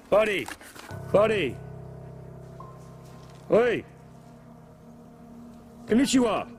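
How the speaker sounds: calling out. Real, not acted. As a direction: yell to get his attention. Calling out to distant man.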